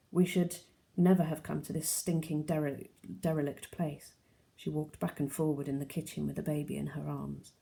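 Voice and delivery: ordinary delivery, nothing marked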